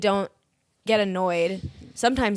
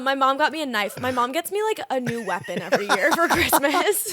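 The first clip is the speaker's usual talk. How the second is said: ordinary talk, nothing marked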